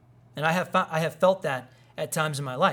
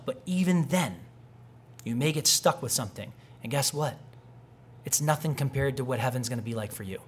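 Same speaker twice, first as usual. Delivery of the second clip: ordinary delivery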